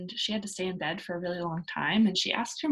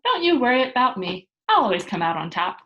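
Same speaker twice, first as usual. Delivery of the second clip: calling up to the sky, louder; called up; 4 semitones higher, 9 dB louder than usual. Acted. Pippi calling up to her mother in heaven, cheerful. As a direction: call it up cheerfully to someone far above. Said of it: "waved up at her and called".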